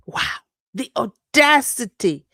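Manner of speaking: slow and loud, each syllable weighed; disgusted emphasis. Real, not acted. Vlogger marvelling at the prosecutor's nerve, slow loud disbelief. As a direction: drag it out in disbelief. Outraged remark.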